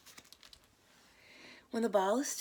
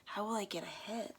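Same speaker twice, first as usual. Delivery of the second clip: inner thought whisper; 4 semitones higher, 5 dB quieter, faster than usual. A narrator's reading. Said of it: "Mo thinks".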